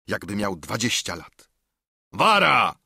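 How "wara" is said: sudden loud, raised warning cry; shouted order to back off; commanding shout. A narrator's reading. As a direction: cut him off with one sharp shout. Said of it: "krzyknął Silver".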